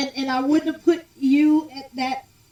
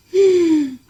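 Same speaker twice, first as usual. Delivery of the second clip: loud shocked gasp; sharp gasp; 4 semitones higher, 11 dB louder than usual. Real, not acted. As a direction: gasp in mock horror. Shocked at bad singing.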